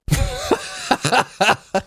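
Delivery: high, wheezy-light laugh; real laughter. Real, not acted. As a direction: crack up at your own joke. Laughing at own joke.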